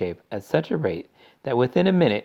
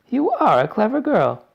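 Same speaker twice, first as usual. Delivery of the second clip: gushing mother voice; 14 semitones higher, 7 dB louder than usual. A narrator's reading. Mother proud.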